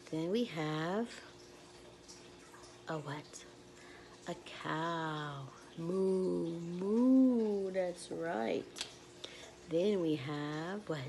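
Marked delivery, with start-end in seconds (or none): 5.8-7.7 mooing, second one louder; cow moo. Retold or imitated. Teacher showing children a cow, playful moo.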